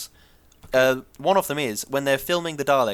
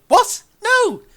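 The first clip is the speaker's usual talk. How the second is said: high, loud cry of disbelief; mock outrage; 7 dB louder than usual. Host reacting to Cockney rhyming slang, exaggerated outrage. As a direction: exclaim in indignant disbelief.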